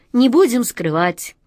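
ordinary delivery, nothing marked

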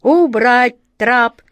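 loud, slow, pitiless order; merciless command. A narrator's reading. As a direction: command it slowly, leaving no room for appeal. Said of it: "безжалостно скомандовала атаман Джина".

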